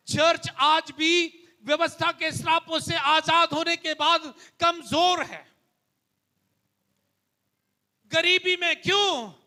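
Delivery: rising pulpit fervour; fervent preaching. Acted. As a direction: preach it to the church with conviction. Preaching about poverty.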